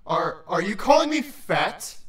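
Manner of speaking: low, indignant creature voice; affronted deep-voiced challenge; yelled offence. A narrator's reading. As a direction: stammer it out, deeply offended. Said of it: "Thorax yelled before frowning".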